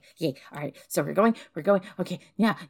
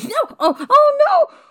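very high, loud panicked yelps; horrified cry; 9 dB louder, slower than usual. Acted. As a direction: cry out in shock at the crash. Just smashed into the car.

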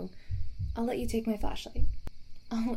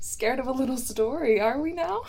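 higher, louder through laughter; laughing while teasing; 10 semitones higher, 8 dB louder than usual. Real, not acted. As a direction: tease them between laughs. Laughing after scaring listener.

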